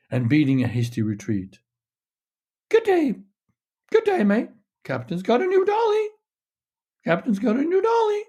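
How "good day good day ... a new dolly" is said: very high squawking parrot voice. A narrator's reading.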